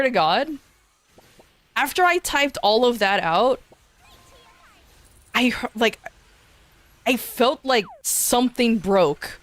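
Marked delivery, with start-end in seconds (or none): none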